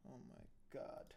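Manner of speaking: shocked whisper. Real, not acted. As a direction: breathe it out in shock. Shocked by the court video.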